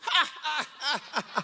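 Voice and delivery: high jeering cackle of chickens; mocking laughter. A narrator's reading. Chickens laughing at the baby eagles, high mocking cackle. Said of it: "the chickens laughed at the baby eagles".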